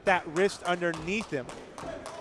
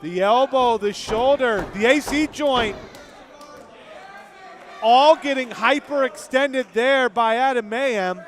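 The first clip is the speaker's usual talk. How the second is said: raised, emphatic play-by-play; wrestling commentator; 5 semitones higher, 4 dB louder than usual. Real, not acted. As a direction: list each body part with punch. Live play-by-play.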